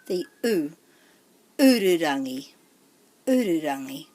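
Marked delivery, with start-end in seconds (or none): 1.6-2.5 "Ururangi" falling from a strong first U; stress on first syllable. Shown as a demonstration. Teacher modelling the name, emphasis on the U starting "Ururangi". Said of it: "put the emphasis on the first syllable". 3.3-4.1 "Ururangi" lower, weight on the first U; stress on first syllable. Shown as a demonstration. Teacher repeating the name, emphasis on the U starting "Ururangi". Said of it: "put the emphasis on the first syllable".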